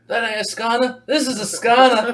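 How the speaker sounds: high, loud parody Aussie boast; Australian accent. Acted. Riffing on the knife line, Australian accent. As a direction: declare it in mock Australian. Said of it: "you were doing an Australian accent".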